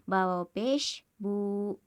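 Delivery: slow, stretch बू a second or two; slow spelled-out recitation. Shown as a demonstration. Teacher demonstrating बू to students, stretched slowly. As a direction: spell बा वाव पेश बू slowly, holding the ऊ of बू for one alif's length. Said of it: "एक second या दो second तक खींचकर पढ़ेंगे".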